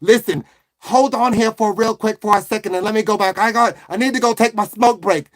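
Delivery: put-on Italian-American waitress; Italian woman. Waitress steering a customer away from Debbie, Italian woman's accent. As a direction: play a chatty Italian-American woman taking orders. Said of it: "Okay, an Italian woman".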